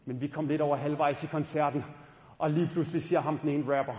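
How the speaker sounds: ordinary talk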